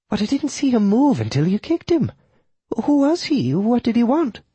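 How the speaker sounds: very high widow character voice; questioning widow. Acted. Widow asks who the dead man was, high questioning voice. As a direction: question him anxiously in a high voice.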